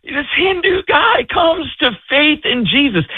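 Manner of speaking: high, emotional wonder, near tears; real awe with a cry in the voice; overcome with amazement. Real, not acted. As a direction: marvel in a high, softened voice. Overcome by the miracle he told.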